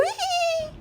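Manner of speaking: witch's cackle. Acted. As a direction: giggle thinly like a witch. Witch gloats. (चुड़ैल की पतली, ऊँची हँसी)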